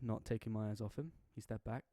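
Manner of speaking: usual speaking voice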